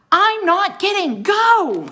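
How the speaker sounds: high, loud and slow defiance; defiant shout. Acted. Jack shouting defiance at the staring wolves, loud. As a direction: stand your ground. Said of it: "shouted Jack".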